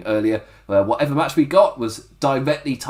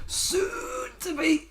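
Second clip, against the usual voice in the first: high, strained mock catchphrase; Zack Gibson impression; 7 dB quieter, slower than usual. Host mimicking a wrestler's serious message, tense strained voice. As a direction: squeeze the words out high and drawn out. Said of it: "You can't do the Zack Gibson thing".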